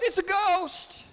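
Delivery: a high, frightened shout; terrified cry. A narrator's reading. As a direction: cry out in fear of a ghost. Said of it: "they cried out".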